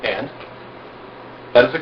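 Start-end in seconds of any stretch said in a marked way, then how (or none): 0.0-0.3 nonchalant. Shown as a demonstration. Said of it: "you go".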